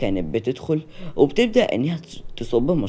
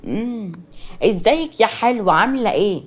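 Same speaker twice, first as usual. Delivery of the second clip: put-on pushy older relative; 7 semitones higher, 5 dB louder than usual. Great-aunt pulling the fiancée aside, overfamiliar. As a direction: greet her with overbearing familiarity.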